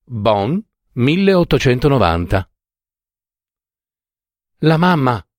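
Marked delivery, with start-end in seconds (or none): 4.6-5.2 bright cry of joyful news; young girl bursting in happily; exultant shout. Acted. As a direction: call it out, thrilled. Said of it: "gridò Jenny, entrando esultante".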